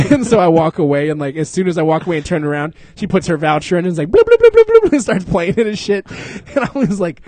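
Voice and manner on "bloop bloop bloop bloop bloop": very high, loud bleeping bloops; slot machine noise. Retold or imitated. Imitating the machine as the lady plays, high bloops.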